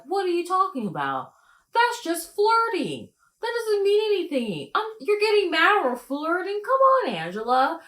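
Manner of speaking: high, loud mocking voice of Tony; imitating Tony. Mocking Tony dodging Angela's questions, high and loud. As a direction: squeak out his excuses like a weaselly liar. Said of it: "He's like".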